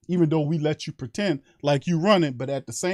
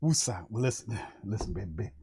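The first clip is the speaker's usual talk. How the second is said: soft, low calming breaths of woosah; 6 semitones lower, 9 dB quieter than usual. Real, not acted. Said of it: "hard for me to bring it down".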